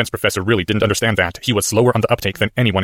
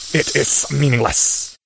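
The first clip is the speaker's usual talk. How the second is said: slow serpent hiss; 5 semitones higher, much slower than usual. A narrator's reading. Snake dismissing.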